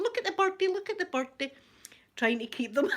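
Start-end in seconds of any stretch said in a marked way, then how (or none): 0.0-1.5 high sing-song look at the birdie; imitating herself calming two crying boys; distracting toddlers. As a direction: coax the little ones with a high playful lilt.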